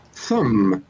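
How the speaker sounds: THUMB drawn out slowly on its own; slow syllable. Shown as a demonstration. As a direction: stretch thumb into one long beat. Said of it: "It's three slow syllables".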